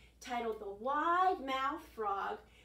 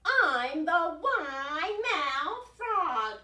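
boastful frog voice; 6 semitones higher, 12 dB louder, much slower than usual. Acted.

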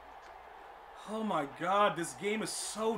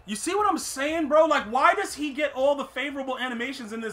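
loud, raised-pitch indignant complaint; heated rant; 5 semitones higher, 6 dB louder than usual. Real, not acted. Gamer venting about favorable animations, heated and loud. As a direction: vent loudly about the unfairness.

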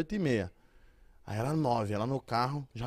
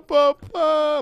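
put-on high, loud baby cry of papá; toddler voice; 10 semitones higher, 7 dB louder than usual. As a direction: squeal it like a hungry toddler. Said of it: "Aí eu".

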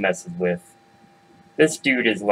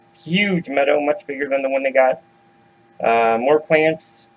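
ordinary delivery, nothing marked

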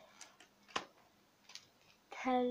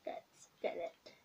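whispering; 5 semitones lower than usual. Pretend. ASMR librarian roleplay.

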